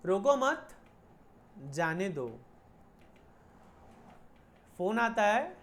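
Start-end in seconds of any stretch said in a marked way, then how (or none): 0.1-2.4 group "रोको मत" as one, break, drop on "जाने दो"; pause after मत. Shown as a demonstration. Teacher voicing police radio order to let jeep pass, pause after "मत". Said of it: "Do not stop, let it go".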